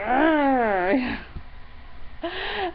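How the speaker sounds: long, high wordless groan; exasperated outburst. Acted. Repressed emotions coming to the surface, drawn-out cry of frustration. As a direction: let pent-up feeling burst out without words.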